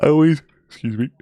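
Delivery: talking through a yawn; words stretched by a yawn. Real, not acted. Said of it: "Need to yawn there for a second".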